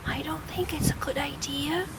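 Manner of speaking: soft unvoiced whisper. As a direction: whisper it to your friend. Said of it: "whispered the tiny bear".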